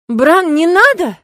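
slow, high, loud desperate plea; pleading cry. A narrator's reading. Nastya begs Bran, pleading loudly and slowly. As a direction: beg him with the whole voice. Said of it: "умоляюще глядя".

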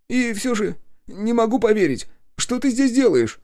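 very high character voice, amazed; high-pitched, incredulous question; disbelieving journalist. A narrator's reading. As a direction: voice a rescued man who can't believe it. Journalist amazed to meet his cousin.

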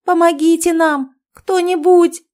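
very high, loud pleading shout; panicked cries of a trapped woman; screaming for help. A narrator's reading. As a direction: yell desperately for anyone to come. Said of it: "кричала Ольга из сторожки".

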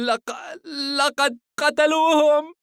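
put-on crying child, very high, halting; shaking sob of a horrified boy; tearful, trembling voice. A narrator's reading. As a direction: choke on the first word, then wail it. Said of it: "بكى وبصوت راجف".

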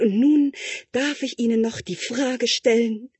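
high, loud, over-the-top praise; shameless gushing. Acted. Tutor shamelessly praising the prince's answer, high and loud. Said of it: "weil er schon ganz schamlos geworden war".

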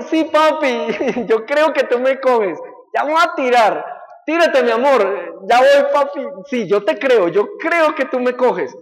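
put-on high, loud eager child; little boy voice. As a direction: play the small son promising to jump. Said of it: "y él".

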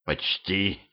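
soft, feeble croak; faint, sickly rasp; wheezing. A narrator's reading. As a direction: wheeze it out, barely audible. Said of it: "просипел я".

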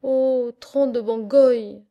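a loud, slow cry from the lookout; sailor's shout. Acted. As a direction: bellow it from the masthead, slowly. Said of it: "cria le gabier".

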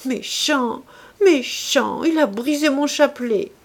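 put-on a young woman near tears, stammering; stammering tearfully. A narrator's reading. (balbutier en larmes, voix aiguë)

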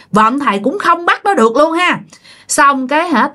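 plain reading voice

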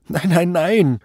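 panicked denial. A narrator's reading. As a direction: rattle out the denial in a high voice. Frightened suspect denies everything. (panisches Abwehren)

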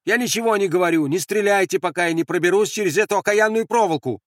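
high-pitched plea, fast at first; urgent shout. Acted. Andres begging them not to shoot, shouting. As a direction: call out before they fire. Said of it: "крикнул он".

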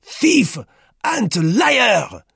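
very high, loud accusation; repeated denunciation. A narrator's reading. Duclos repeats his accusation at Roux, loudly. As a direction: trumpet each insult separately. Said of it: "he repeated".

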